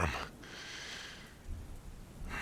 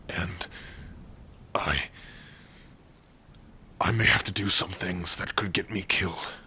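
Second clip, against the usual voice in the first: ordinary delivery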